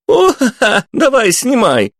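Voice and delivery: put-on high, loud teen whoops; rowdy teen voice. A narrator's reading. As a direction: yell like a rowdy teenager. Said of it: "надрывались подростки".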